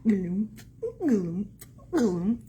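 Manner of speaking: loud, separated plods; plodding camel steps. Acted. Reader shows child how a camel walks, loud plodding steps. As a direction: land each word like a heavy hoof.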